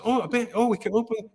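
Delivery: a hushed excited producer, up high; imitating Gary. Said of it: "He goes".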